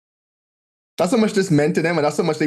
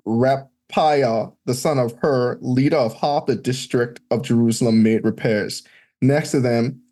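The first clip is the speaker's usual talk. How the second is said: scripture reading; 6 semitones lower than usual. Acted. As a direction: read out the old names like a lector. Reads scripture list aloud.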